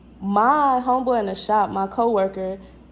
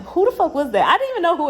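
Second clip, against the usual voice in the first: higher, louder burst of disbelief; shocked outburst; 8 semitones higher, 4 dB louder, faster than usual. Retold or imitated. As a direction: blurt it out, baffled. Retold shock after weird call.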